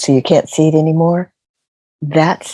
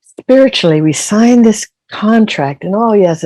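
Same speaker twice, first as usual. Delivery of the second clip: higher and louder, eager; eager signing up; 6 semitones higher, 4 dB louder than usual. Retold or imitated. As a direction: sign up with bright eagerness. Acting eager soul signing contract.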